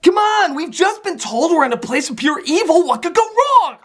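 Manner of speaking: high-pitched, incredulous mock protest; mock-naive exclamation. Reviewer mocks the heroes walking into pure evil, mock-naive exclamation. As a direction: pitch it way up in oblivious disbelief.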